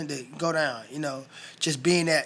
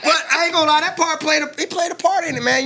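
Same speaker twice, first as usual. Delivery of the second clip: laughing; 11 semitones higher, 6 dB louder than usual. Real, not acted. Still laughing after the joke.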